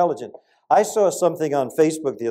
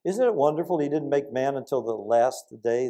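ordinary delivery

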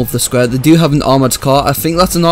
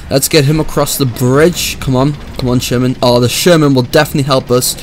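ordinary talk, nothing marked

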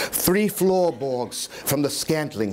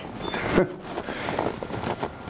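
hushed giggle; 6 semitones lower, 7 dB quieter than usual. Acted. Madman giggles over the dead eye.

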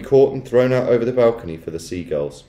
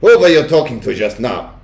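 loud witch snap with a put-on accent; snapped; 3 semitones higher, 7 dB louder than usual. A narrator's reading. Witch questioning grandmother, snapping. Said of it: "snapped the Grand High Witch".

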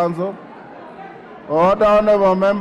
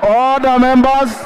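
raised, high, insistent calls over the noise; calling for order; 6 semitones higher than usual. Real, not acted. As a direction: keep hammering for order above the din. Speaker calling rowdy House to order.

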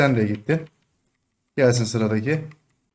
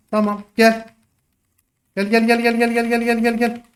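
taunting call; 7 semitones higher than usual. Real, not acted. Chanting taunt at enemy.

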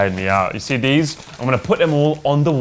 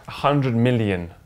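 usual speaking voice

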